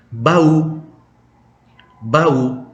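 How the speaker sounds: bau with the u stressed and loud. Shown as a demonstration. Teacher models Portuguese bau, stress on the U. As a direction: lean on the u in bau. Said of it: "tekannya di U".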